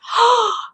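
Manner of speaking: sharp high gasp of delight; breathless fangirl gasp; swooning gasp. Real, not acted. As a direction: catch your breath in awe. Swooning over a character's quotes.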